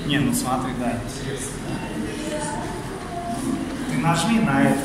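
ordinary talk

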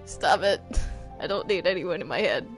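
ordinary talk

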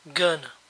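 "gonna" said as one blended word, "ga-na"; relaxed spoken "gonna". Shown as a demonstration. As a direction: clip going to down to "gonna". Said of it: "se pronuncian a veces gonna".

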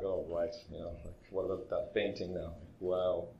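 soft, mocking aside under the breath; sarcastic inner voice. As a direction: sneer at the painting quietly to yourself. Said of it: "so I'm just being super sarcastic in my mind".

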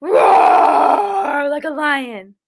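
slow, roaring lion voice. Shown as a demonstration. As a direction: roar it out like a lion. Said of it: "Can everyone roar for me".

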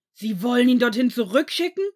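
high, very loud incredulous yell; outraged shout. A narrator's reading. Severus leaps up at the headmaster, shouting. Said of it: "schrie er".

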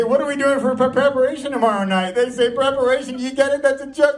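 normal talking voice

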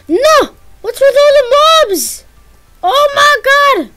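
high, loud alarmed yelling; alarmed cries. Real, not acted. Gamer yells in alarm at the mobs, high and loud. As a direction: cry out at the swarm.

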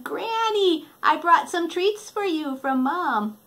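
put-on high, sweet girl; lightly voiced little Red. Red bringing treats to granny, high girlish.

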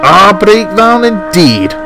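high, slow, scornful outburst; seething Scottish twin. A narrator's reading. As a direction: draw it out with indignant fury. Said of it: "Douglas seethed".

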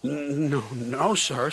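nervous stutter to a superior. A narrator's reading. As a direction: stumble on the first word, timidly. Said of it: "said Oliver nervously".

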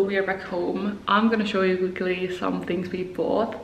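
ordinary talk

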